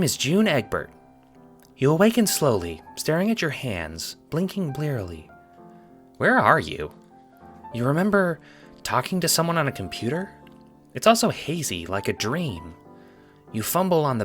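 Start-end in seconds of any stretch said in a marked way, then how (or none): none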